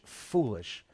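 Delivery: usual speaking voice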